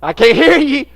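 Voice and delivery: high, loud imitated yell from across the room; mom yelling. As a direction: bark it like a mother ordering from the background. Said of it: "my mom's in the background going".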